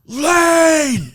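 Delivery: high, loud drawn-out cry of the word; the final warning, full force; loud yell. Retold or imitated. Coach's last shout to clear the inside lane, drawn-out yell. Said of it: "He's like".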